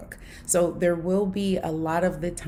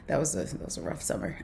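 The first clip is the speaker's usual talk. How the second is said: breathy, voiceless aside to herself; whispered side comment about a hard summer; 3 semitones lower, 8 dB quieter, faster than usual. Real, not acted. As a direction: drop it to a rueful whisper. Rueful aside.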